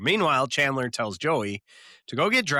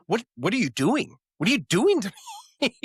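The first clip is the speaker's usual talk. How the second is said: pissed off Ross, retold; 10 semitones higher, 3 dB louder, faster than usual.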